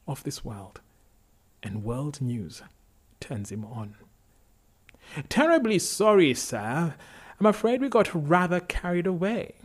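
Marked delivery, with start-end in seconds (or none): 5.3-9.5 loud, high-pitched English gentleman apology; English accent. A narrator's reading. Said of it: "voice is imported from England".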